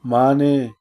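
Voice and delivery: ordinary delivery, nothing marked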